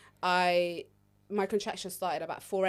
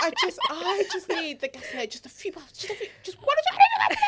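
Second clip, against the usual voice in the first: high, desperate whimpering plea; mock crying plea; 10 semitones higher, 6 dB louder than usual. Imitating herself begging for gas, tearful. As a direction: beg in a hammed-up sob. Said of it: "I said, please, I just need".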